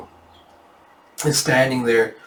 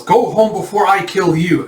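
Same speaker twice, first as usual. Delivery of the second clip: raised, forceful warning; threatening; 6 semitones higher, 4 dB louder than usual. Acted. Yona from roof to bandits, high threatening order.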